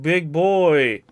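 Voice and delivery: ordinary talk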